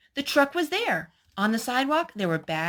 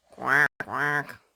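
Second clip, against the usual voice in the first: duck quack; 5 semitones lower than usual. Pretend. Reading animal book to child, playful duck quack. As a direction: lower mock duck quacking.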